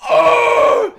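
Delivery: a sudden, very high, loud cry; startled shout. Retold or imitated. Mimicking friend jolting awake at night, high startled shout. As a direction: yelp it like you just woke in a panic.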